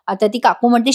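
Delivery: normal talking voice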